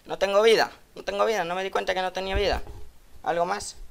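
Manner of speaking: high, loud cry of disbelief; exasperated disbelief. Real, not acted. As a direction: protest that he has no life left. Realises his health is gone. (incredulidad exasperada, aguda)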